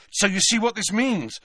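high, urgent rush; at a gallop. A narrator's reading. Boy explaining to friends with no time, hurried. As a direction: hurry to the end of the explanation. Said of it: "Harry finished at a gallop".

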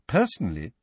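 ordinary delivery, nothing marked

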